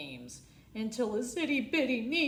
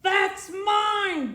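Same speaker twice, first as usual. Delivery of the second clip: loud, higher bossy bellow; bellowing bully; 8 semitones higher, 7 dB louder than usual. Acted. Beth Ann claiming the last cupcake, bellowing. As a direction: roar it like a greedy little queen. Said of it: "bellowed Blabbering Beth Ann".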